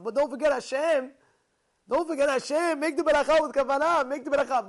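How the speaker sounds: loud, high, urgent pleading; fervent preacher calling out a reminder; impassioned exhortation. Acted. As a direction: raise the voice and drive each command home. Exhorting eater to remember blessing.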